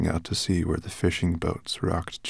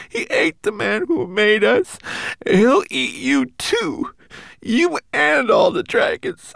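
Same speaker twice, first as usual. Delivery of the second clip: high, small homunculus voice; a tiny frightened creature warning; 6 dB louder than usual. Acted. Homunculus Twigleg's voice.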